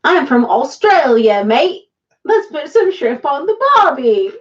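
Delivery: bad Aussie impression, hammed up; Australian accent. Shown as a demonstration. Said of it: "Nicole Kidman in her native Australian language".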